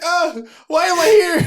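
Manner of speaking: high, loud, slow bewildered wail; starstruck panic. Retold or imitated. Frozen engineer asking himself why he's there, high panic. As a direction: wonder aloud why you're even there. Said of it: "I'm just, like, frozen".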